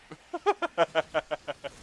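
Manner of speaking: amused staccato giggle; giggling laugh. Real, not acted. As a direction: laugh it off after an outburst. Laughs after yelling at enemy.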